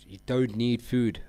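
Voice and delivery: ordinary delivery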